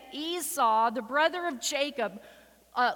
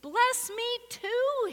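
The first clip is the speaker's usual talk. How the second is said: begging cry; 8 semitones higher, 3 dB louder than usual. A narrator's reading. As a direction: cry out to a father for a blessing. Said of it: "he begged".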